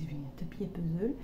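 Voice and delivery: whispered thought. Retold or imitated. Retelling her own thought.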